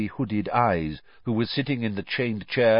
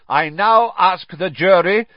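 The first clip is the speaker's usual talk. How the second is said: loud, raised judge's call; bellowed address; 8 semitones higher, 7 dB louder than usual. A narrator's reading. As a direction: call on the jury at normal speed. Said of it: "bellowed Mister Crouch".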